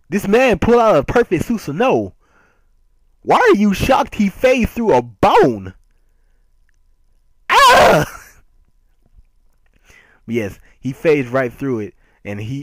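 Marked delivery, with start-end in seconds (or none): 0.1-5.7 high, loud outburst of disbelief; incredulous shouting. Acted. Mock outrage at shocked character. 7.5-8.2 very high, loud scream; exasperated scream. Acted. Manga fan caps his rant at the character, exasperated scream.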